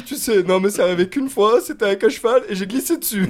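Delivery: imitation légère d'un mec frimeur, plus fort; Ali G. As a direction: fais le frimeur en riant. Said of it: "Le mec en mode Ali G". (Ali G en riant)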